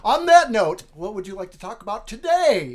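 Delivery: loud, high, booming show-host question; mock announcer launch. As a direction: belt out the segue like a hammy host. Host launches the day's topic.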